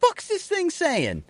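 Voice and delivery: high, slow, puzzled dog voice; confused dog voice. Pretend. A dog baffled by its owner's language, high, slow and puzzled. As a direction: play the dog baffled by a foreign command. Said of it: "dog cocking its head".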